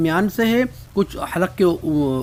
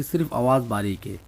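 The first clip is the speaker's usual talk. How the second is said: ordinary delivery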